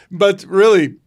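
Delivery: laughing loudly. Real, not acted. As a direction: burst of laughter into words. Laughing at son's mishap.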